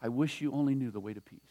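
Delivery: a sorrowing wish, barely above a murmur; grieving. Acted. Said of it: "He says".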